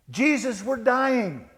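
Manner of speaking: high, loud wail of acted panic; hysterical plea. Disciples pleading with Jesus in the boat, hysterical. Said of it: "A little hysterical, don't you think?".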